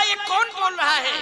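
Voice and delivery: loud, high demand for who spoke; miller's loud demand. Retold or imitated. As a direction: call out like a startled miller. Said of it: "पीसने वाला बोला". (ज़ोर से ऊँची आवाज़ में पूछना)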